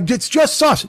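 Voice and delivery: high-pitched mock whine; complaining Italian reactor. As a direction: gripe it high, as if offended. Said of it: "the fucking Italian guy goes".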